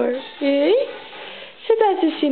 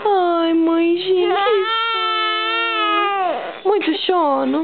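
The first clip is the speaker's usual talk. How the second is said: voicing the baby with a put-on whimper; whiny sleepy baby; 3 semitones higher than usual. Mother voicing her sleepy baby, whiny crying voice. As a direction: whimper it like a sleepy toddler to mommy. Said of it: "Conta pra mãe".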